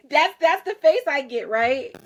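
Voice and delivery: ordinary talk, nothing marked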